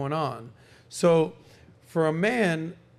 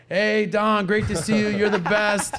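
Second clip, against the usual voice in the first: put-on a higher, louder, showy hello to an old friend; 5 semitones higher, 5 dB louder, slower than usual. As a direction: play up the big-shot welcome. [name] scripts [name]'s greeting.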